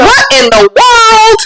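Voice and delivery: high, slow drawn-out exclamation; hammed-up childish shock; kid's stand-in for a curse; mock astonishment. Imitating kids' substitute exclamation.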